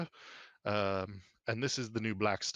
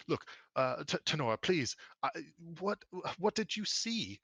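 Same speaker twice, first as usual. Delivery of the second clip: higher, halting appeal; pleading; 7 semitones higher than usual. Acted. Father begs Tenora to say what she saw, pleading.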